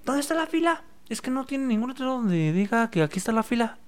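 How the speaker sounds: high, whiny complaining customer; whiny customer imitation. Cliente quejándose de la fila. (cliente quejumbroso, voz aguda)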